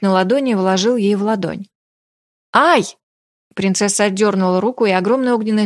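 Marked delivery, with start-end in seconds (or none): 2.5-2.8 short high "ow", rising then falling; acted startled little cry; startled yelp. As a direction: yelp as if singed. Startled by fire in palm.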